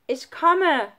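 high, loud, determined shout; calling out. A narrator's reading. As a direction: shout up that you're on the way. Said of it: "rief er". (laut und entschlossen gerufen)

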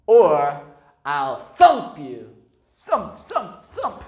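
slow loud threat, playful thumps; threatening kangaroo. A narrator's reading. Kangaroo threatening Stephen for apples, loud then playful. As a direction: threaten to thump, half laughing. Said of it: "said the kangaroo".

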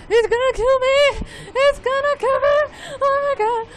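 high, loud panicked cry; terrified horror victim. Real, not acted. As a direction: panic as death closes in. Boss about to kill.

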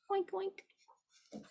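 high oinking pig; pig oinks. Acted. Narrator oinking for the pig. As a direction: squeal it out like a piglet. Animal noises in counting story.

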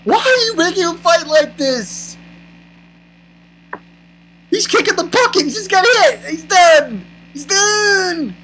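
worked-up fan berating the author; exasperated rant. Real, not acted. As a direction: whine in outrage, stretching the last words.

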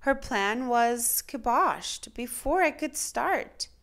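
usual speaking voice

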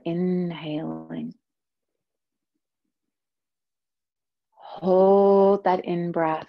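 very slow, stretched "inhaling"; drawn-out cue. Shown as a demonstration. Guide slowing students into breath retention, drawn out. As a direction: draw out "inhaling" like a long breath in. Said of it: "cues the held breath".

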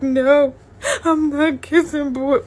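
put-on high, loud, drawn-out child's wail; mock tearful protest. Child protesting before getting hit, mock crying plea. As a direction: imitate a whining child denying it.

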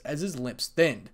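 plain reading voice

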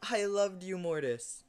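high, loud despairing cry; anguished wail. Real, not acted. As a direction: wail over the lost teammate. Exits game by mistake.

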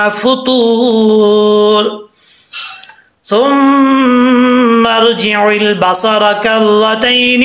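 slow, melodic Quranic chanting with long held vowels; Quran recitation. Acted. Teacher chanting the verses before the lesson, slow melodic recitation. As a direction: recite it slowly, drawing out each vowel.

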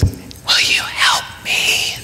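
put-on slow, timid child whisper; shy whisper. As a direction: whisper it, hiding behind dad's leg. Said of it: "And so she goes".

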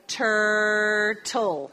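Turtle said slowly and clearly in English, falling at the end; slow English model word. Shown as a demonstration. Teacher modelling a word for students, slow and clear. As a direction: model Turtle for the class, drawn out. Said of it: "¿Qué oyen?".

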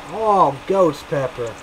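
usual speaking voice

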